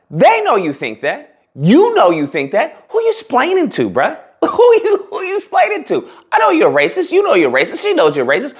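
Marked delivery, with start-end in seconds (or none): 2.9-6.1 laughing disbelief. Real, not acted.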